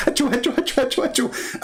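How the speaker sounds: high squeaky mock sneezes; hammed-up sneezing; fake sneezes.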